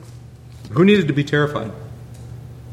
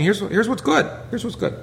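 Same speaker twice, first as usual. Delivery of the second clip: lightly voiced raised instruction; a higher, eager voicing of the commands; God's instruction quoted; 8 semitones higher than usual. Preacher quoting the law's message to the saints, raised and bright. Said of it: "They're saying".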